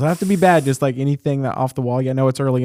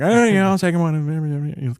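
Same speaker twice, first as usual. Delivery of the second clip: slow drawl reply; 4 semitones higher, slower than usual. Retold or imitated. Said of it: "They're like".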